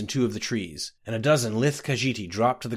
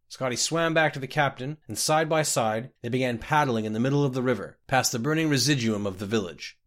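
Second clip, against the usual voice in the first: ordinary delivery, nothing marked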